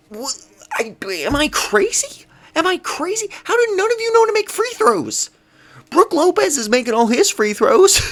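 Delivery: rising to a disbelieving near-shout; exasperated outburst. Real, not acted. Fan ranting at his team, exasperated and loud. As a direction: voice climbing high and loud in disbelief.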